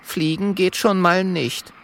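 high, mildly resigned dragon; lightly resigned small voice; sighing little dragon. A narrator's reading. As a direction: admit it with a touch of a sigh. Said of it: "Kokosnuss seufzt und sagt".

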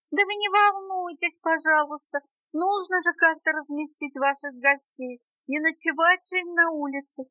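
high, merry small voice; chirpy spider brushing off worries; cheerful little spider. A narrator's reading. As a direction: explain it brightly, pitched well up. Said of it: "весело объяснил паучок".

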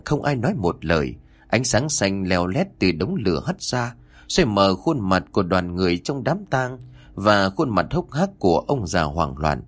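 ordinary delivery

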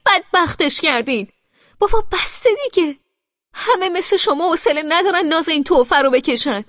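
loud, high, shouting tirade; furious rant. A narrator's reading. Brother shouting at his mother about the sister, furious. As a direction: rage at the mother, voice cracking high.

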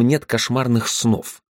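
plain reading voice

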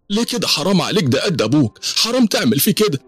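very high, wailing protest; high-pitched acted old woman crying out; distraught old woman. A narrator's reading. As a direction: plead frantically for him to be let go. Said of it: "ست كبيرة طلعت من بيتها تجري وهي مغضوضة".

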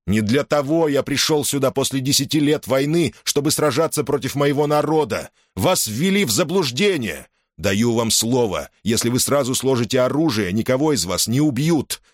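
high, firm, ringing promise; commanding general's promise. Acted. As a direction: reassure troops as their general. Caesar speaks while riding.

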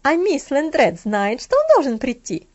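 wary changed tone. A narrator's reading. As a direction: switch to a different, careful voice. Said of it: "голос её звучал теперь совсем по-другому".

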